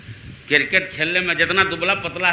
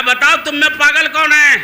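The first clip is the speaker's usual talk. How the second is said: insistent demand; 8 semitones higher, 4 dB louder than usual. Acted. Father demands which son is mad.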